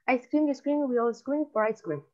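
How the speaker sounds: quick-fire tongue twister, phrases run together; rapid demonstration. Shown as a demonstration. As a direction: rattle it off without pausing. (trabalenguas dicho rapidito)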